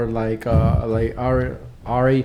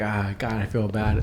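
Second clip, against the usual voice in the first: softer, lower guilty groan; mock guilty sigh; 5 semitones lower, 6 dB quieter, slower than usual. To himself about the beer, soft guilty sigh. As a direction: quietly wince at yourself.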